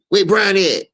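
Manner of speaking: high, loud demand; loud, high-pitched impatient summons. Retold or imitated. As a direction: call out sharply for someone, pitched well up. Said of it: "why you think [name] say".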